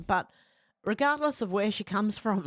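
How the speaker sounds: normal talking voice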